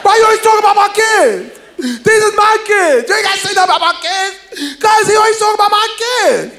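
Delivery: put-on aunt yelling at uncle over her kids; aunt going off. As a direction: tear into him for talking about her kids. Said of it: "she go off".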